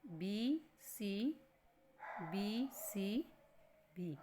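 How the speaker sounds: बहुत धीरे-धीरे अक्षर बोलते हुए; slow spelled-out letters. Shown as a demonstration. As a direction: drag out each letter very slowly. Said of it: "तो ये इसमें क्या बना".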